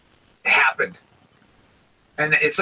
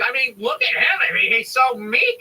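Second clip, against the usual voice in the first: mock conservative relatives; 7 semitones higher than usual. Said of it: "all my relatives who are conservative are like".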